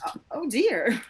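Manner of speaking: frightened little whisper. Acted. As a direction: breathe it, startled. Startled whisper.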